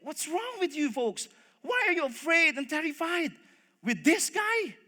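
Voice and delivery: high-pitched incredulous challenge; indignant. Acted. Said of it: "he got offended".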